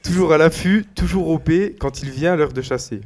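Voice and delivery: slow, showy presenter read; announcer intro. Acted. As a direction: present him like a show host. Reads a dog-style intro of Hugo.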